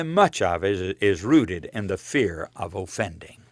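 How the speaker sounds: ordinary talk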